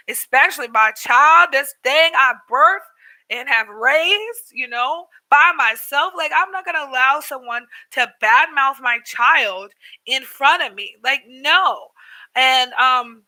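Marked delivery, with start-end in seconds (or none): 0.1-11.9 real emphatic indignation, stressed word by word; fierce protectiveness. Real, not acted. Mother refuses to let anyone badmouth her child, adamant. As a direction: lay down the line as a protective parent.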